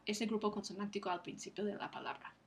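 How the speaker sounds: ordinary talk, nothing marked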